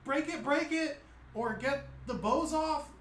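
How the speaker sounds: put-on high, loud pleading teen; agitated goblin teenager giving orders. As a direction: beg them urgently as the goblin. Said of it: "She's like".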